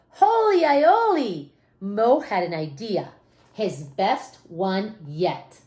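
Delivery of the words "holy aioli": excited exclamation. A narrator's reading.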